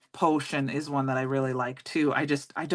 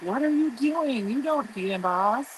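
incredulous; 7 semitones higher, slower than usual. Retold or imitated. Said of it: "I was like".

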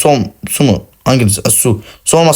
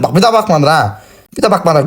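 ordinary delivery

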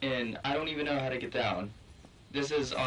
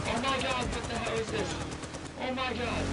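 shocked alarm; 10 semitones higher than usual. Real, not acted. Under sudden attack.

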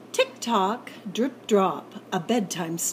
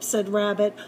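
usual speaking voice

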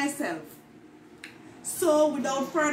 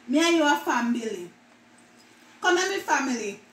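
casual patois example lines; Jamaican patois. Shown as a demonstration. Vlogger acting out patois examples about family. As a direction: say it like family back home. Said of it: "words that Jamaican mispronounce".

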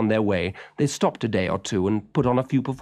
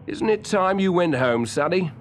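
lightly voiced circus man, a touch louder; a hint of a working man telling a boy off kindly; gruff circus man; 8 semitones higher, 4 dB louder than usual. A narrator's reading.

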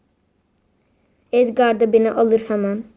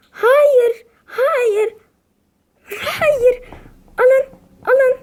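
high, loud sobbing protest; crying wail; 11 semitones higher, 5 dB louder, much slower than usual. Real, not acted. Player begging as his team loses, loud crying wail. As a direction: cry out in real despair. Team about to lose the match.

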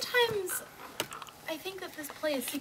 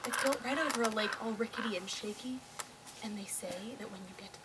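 whispering; 4 semitones lower, 6 dB quieter than usual. Acted. Scary roller coaster description.

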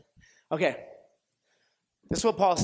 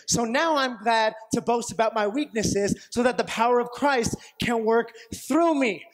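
ordinary delivery

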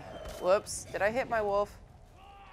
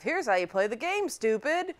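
higher-pitched mocking game-tutorial voice; 6 semitones higher than usual. Game tutorial talking down to a new player, mock-condescending. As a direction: talk down to a clueless player, pitched up. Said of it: "the first sort of".

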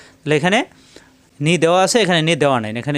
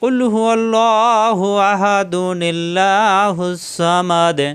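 Quran recitation; 3 semitones higher, much slower than usual. Shown as a demonstration. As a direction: recite slowly, drawing out each vowel. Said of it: "মিলিয়ে পড়লে".

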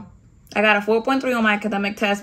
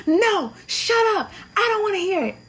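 angry cutoff; 11 semitones higher than usual. Retold or imitated. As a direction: shut them down hard. Chief cutting off recruit's excuse.

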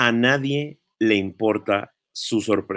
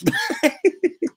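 high mocking burst of laughter. Real, not acted. Vlogger laughing at the woman's post, high mocking laugh.

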